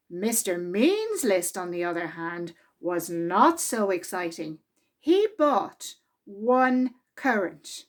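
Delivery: lively, expressive read-aloud voice; children's story reading. A narrator's reading. Narrator reading a Mister Men story to children, lively and expressive. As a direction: read it to a room of small children. Reads picture book aloud.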